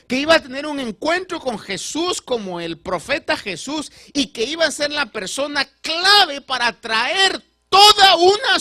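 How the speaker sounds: rising to a loud, high preaching peak; preaching at full force. Acted. Preacher to congregation, building to a loud, high climax. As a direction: build the sermon to a ringing climax.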